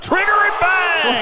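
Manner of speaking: big rising shout on the shot; excited call. Real, not acted. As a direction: call the three-pointer with a burst of excitement. Three-pointer drops in live.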